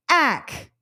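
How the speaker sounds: "ack" said alone as the rhyme of track; isolated rime "ack"; rhyme sound. Shown as a demonstration. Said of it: "Say the rhyme of track".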